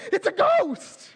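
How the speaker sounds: high, loud, slow frightened shriek; high and loud, the disciples panicking in the boat; terrified cry. Acted. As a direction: cry out like you've seen a ghost. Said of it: "they were terrified and said".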